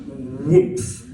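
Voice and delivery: high, loud mock whoomph of a face landing in food; bursting imitation of a body flopping forward; imitated whoomph. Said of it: "this whoomphing sound".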